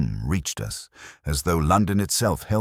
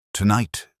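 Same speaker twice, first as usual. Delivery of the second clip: voice breaking; 4 semitones higher than usual. A narrator's reading. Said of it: "his voice broke".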